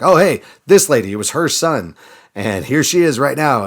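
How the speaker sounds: high, loud burst of retold surprise; excited recognition. Servant points out the lady to the king, excited surprise. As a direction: act out suddenly spotting someone, voice jumping up.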